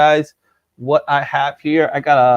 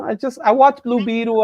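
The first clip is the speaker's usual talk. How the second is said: ordinary talk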